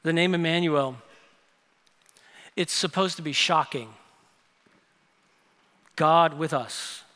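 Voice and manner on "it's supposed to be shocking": ordinary talk, nothing marked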